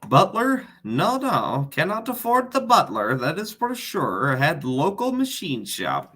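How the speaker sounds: put-on Borat accent, rising high and dropping back; lilting mock Borat accent. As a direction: answer in a hammed-up Borat accent. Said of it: "Borat accent".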